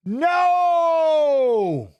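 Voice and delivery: a sudden high, loud cry of No!; real outcry. Real, not acted. Streamer watching the bout yells out in dismay, high and loud. Reacting to the fight live.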